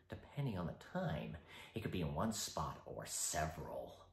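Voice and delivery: soft, low, teasing Joker; icy polite interrogator. Acted. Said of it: "interrogation scene involving ... the Joker".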